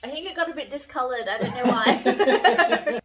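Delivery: laughing through the words, trailing into a giggle. Real, not acted. Looking at the discolored snow globe, laughing it off. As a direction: laugh it off, amused.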